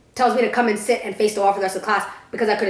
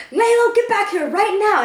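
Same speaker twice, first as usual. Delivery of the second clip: much higher, louder mock yell from a teacher; 10 semitones higher, 3 dB louder than usual. Teacher calling after the student walking out, mock yell. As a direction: call the student back sharply. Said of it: "she was like".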